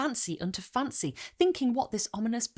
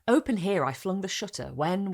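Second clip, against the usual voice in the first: plain reading voice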